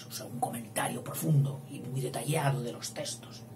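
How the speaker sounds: softly, almost whispered; murmured explanation; quiet aside. Real, not acted. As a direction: drop to a hushed aside.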